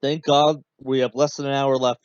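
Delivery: ordinary delivery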